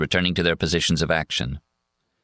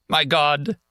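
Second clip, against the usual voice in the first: put-on high, wailing drunk; drunken groan; 10 semitones higher than usual. A narrator's reading. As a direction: wail it in mock despair. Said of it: "he groans".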